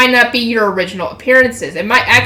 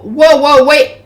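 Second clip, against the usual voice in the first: higher, louder cry to stop; mild panic; 3 semitones higher, 6 dB louder, slower than usual. A narrator's reading. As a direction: call out to halt it, a touch alarmed. Character panics as the entrance closes.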